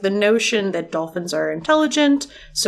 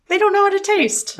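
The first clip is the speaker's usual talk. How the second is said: high squeaky dolphin talk; 10 semitones higher than usual. Pretend. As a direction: speak like a chirpy dolphin. Dolphin voice continued.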